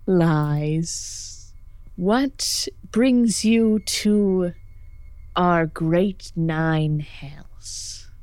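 very slow, drawn-out demon voice; snide, unhurried interrogation. Acted. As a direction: stretch each word like a snide devil toying with intruders. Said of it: "this sort of snide look and goes".

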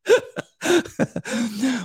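high delighted laugh. Real, not acted. Laughing at own word choice.

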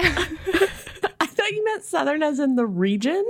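high, slow words through laughter; laughing. Real, not acted. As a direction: laugh through the whole line. Laughing at own misunderstanding.

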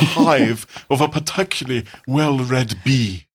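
pretentious art critic impression; Brian Sewell. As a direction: declaim it as a pompous art critic, drawing out the ending. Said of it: "Sorry, that was Brian Sewell still".

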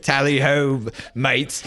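chirpy Tally ho soldier; mock British soldier. Friend mocking overconfident WWI soldiers with a British cheer.

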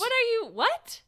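baffled. Retold or imitated. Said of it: "so he would be like".

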